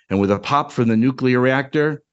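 usual speaking voice

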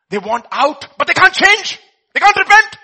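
high, very loud preaching cry; impassioned shout. Real, not acted. Preacher on those in hell who can't change, shouted with force.

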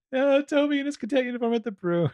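hammed-up high Southern swoon; Jeff Sessions Southern impression. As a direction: gush it in a falsetto Southern accent. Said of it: "that's my Jeff Sessions impression".

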